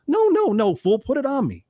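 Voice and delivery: very high, mock protest; Les Brown. Les corrects the speaker before the crowd, high-pitched protest. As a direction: shoot the pitch up in playful objection. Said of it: "he says in front of all the people".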